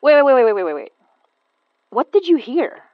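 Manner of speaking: loud, high urgent pleading; urgent alarm. Acted. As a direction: stop her in a panic.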